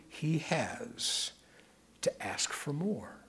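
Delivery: whispered, intimate; whispered aside. Real, not acted. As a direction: lean in and whisper it. Preacher drops to whisper.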